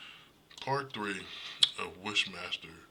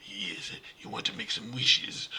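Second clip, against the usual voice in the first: slow, drawn-out sinister genie voice; original Wishmaster's voice; 6 dB louder than usual. Retold or imitated. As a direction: imitate a menacing demon offering wishes, louder and slower. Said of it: "The other guy had this voice".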